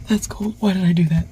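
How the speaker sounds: high pained yelp at the cold. Real, not acted. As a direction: squeal it, hands freezing. Reacting to cold snow.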